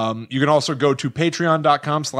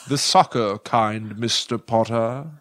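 put-on British accent; 4 semitones lower, slower than usual. A Whitcomb player tells Mister Potter in a British accent. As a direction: drawl it like a prim Brit. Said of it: "That's British".

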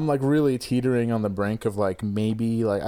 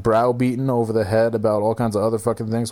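usual speaking voice

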